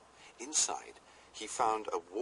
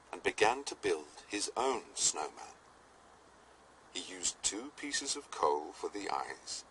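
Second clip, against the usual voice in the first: ordinary delivery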